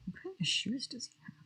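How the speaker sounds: soft unvoiced whisper. Real, not acted. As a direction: breathe it, barely audible. Sudden hushed aside on stream.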